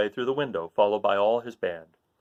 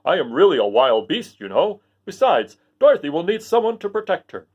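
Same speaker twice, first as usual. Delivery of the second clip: loud, bold Lion voice; a proud wild beast; 4 semitones higher, 6 dB louder than usual. Acted. Lion vowing to protect Dorothy. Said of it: "declared the Lion".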